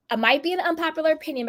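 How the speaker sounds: ordinary talk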